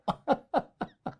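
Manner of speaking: breathy burst of laughter at himself; real laugh. Real, not acted. A man laughing at his own story about eating too many eggs, real laughter. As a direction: laugh it off, amused by the memory.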